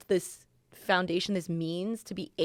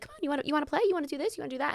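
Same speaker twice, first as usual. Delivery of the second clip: put-on high, eager kid voice; eager little boy; 8 semitones higher, much faster than usual. Mother imitating her son asking kids to play, high kid voice. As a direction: pitch it up like a toddler inviting a playmate. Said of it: "he'll be like".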